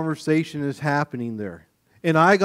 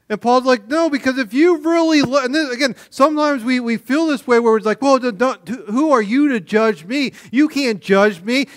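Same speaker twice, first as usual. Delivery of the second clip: much higher, louder preaching peak; fervent preaching; 8 semitones higher, 4 dB louder than usual. Acted. Pastor driving home sin in Corinth, fervent. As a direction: pour it out at full force. Preaching peaks, voicing defiance.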